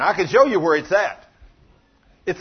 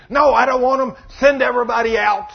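loud, higher, angry demand; upset shout; 7 semitones higher, 7 dB louder than usual. Retold or imitated. The son, upset, ordering everybody out of the room. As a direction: bark them all out of the room. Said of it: "He gets all upset and says".

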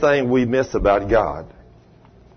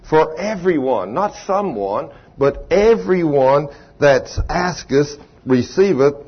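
King James reading to the congregation; scripture reading; 4 semitones higher than usual. Acted. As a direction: read the verses with weight. Reads Scripture aloud.

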